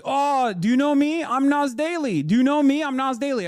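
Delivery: high, loud, eager mock voice; mock Nas Daly. Mocking the influencer who cornered him at the airport, high and eager. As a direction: ham up a pushy influencer introducing himself. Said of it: "And he was like".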